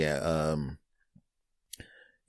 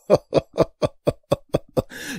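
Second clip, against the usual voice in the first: Joker cackle; 10 semitones higher than usual. Acted. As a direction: cackle like a gleeful supervillain. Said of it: "laughing like the damn Joker".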